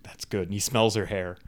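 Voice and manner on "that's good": soft mock menace; menacing. Creepy man caressing a woman, softly menacing. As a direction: imitate a quiet, creepy approval. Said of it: "menacingly, and he's, like".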